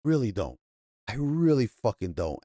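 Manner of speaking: low, tired-of-it indifference; apathetic. Real, not acted. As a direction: shrug it off, voice dropping away. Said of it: "there's just a lot of apathy".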